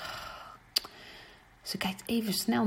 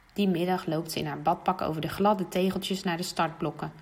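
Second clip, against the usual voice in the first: ordinary delivery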